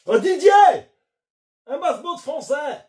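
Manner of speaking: crié fort et aigu à travers la boutique; appel à Didier. Acted. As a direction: lance le nom pour appeler un collègue au loin. Le vendeur appelle un collègue. (le vendeur appelle Didier en criant)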